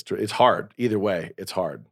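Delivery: ordinary talk, nothing marked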